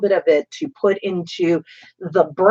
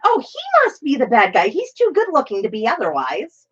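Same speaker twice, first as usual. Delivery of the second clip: higher mom voice; lightly voiced clueless mom; mother voice; 8 semitones higher than usual. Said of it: "she went".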